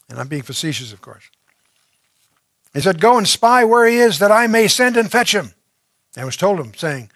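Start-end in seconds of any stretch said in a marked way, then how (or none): 3.0-5.5 higher and louder order; king's command. Acted. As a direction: bark the order to go spy. King orders spies sent.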